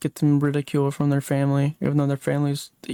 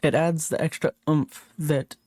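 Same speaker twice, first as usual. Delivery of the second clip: ordinary delivery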